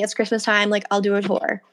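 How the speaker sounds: ordinary delivery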